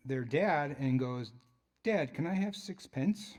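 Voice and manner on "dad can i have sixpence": lightly voiced child, a bit higher; a hint of a small boy asking nicely; child asking dad. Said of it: "he has that little English accent".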